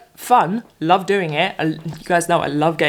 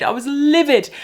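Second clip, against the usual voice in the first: higher and raised, a touch less; livid; 7 semitones higher than usual. Real, not acted. Repeating the complaint about Instagram, still fuming.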